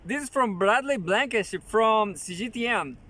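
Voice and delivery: normal talking voice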